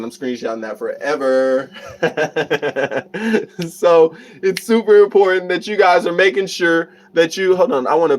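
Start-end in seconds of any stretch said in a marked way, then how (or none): 1.9-3.6 real delighted laugh. Real, not acted. Streamer praised for a British accent, delighted laugh. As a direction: burst into pleased laughter.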